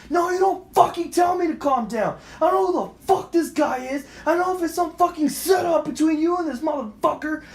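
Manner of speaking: high, furious re-enacted shout; full-voiced retold tirade; retold screaming and yelling. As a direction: refuse to calm down, yelling. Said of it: "I'm screaming and yelling".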